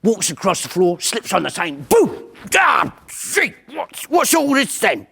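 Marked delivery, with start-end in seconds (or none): 1.9-5.0 prop's yelp. Retold or imitated. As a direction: yelp as you land in the mess.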